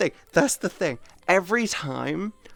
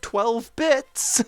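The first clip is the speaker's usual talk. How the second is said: higher, slowed chirpy thanks; 6 semitones higher, slower than usual. Retold or imitated. A streamer thanking a viewer for twelve bits, higher and chirpy. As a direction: thank them sweetly on autopilot. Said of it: "And I go".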